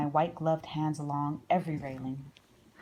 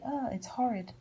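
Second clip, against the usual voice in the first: higher, mock-squeamish squeal of disgust; lightly voiced girl recoiling from a frog; 7 semitones higher than usual. A girl who sees a little green frog, mock squeamish higher squeal.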